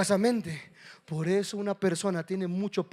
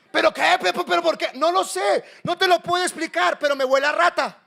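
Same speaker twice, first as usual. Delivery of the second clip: high, raised, exasperated voice; acted heated back-and-forth, pitched up; exasperated exchange; 10 semitones higher, 6 dB louder than usual.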